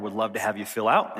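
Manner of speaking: ordinary talk, nothing marked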